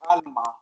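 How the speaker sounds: "Alma" as AHL-mah, said alone; "Alma" with open vowels; Spanish pronunciation. Shown as a demonstration. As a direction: present the Spanish name "Alma" clearly. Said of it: "In Spanish, the name Alma is".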